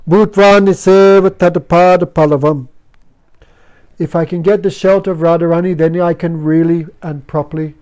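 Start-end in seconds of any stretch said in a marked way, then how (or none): 0.1-2.7 verse recitation. Shown as a demonstration. Reciting Sanskrit verse line.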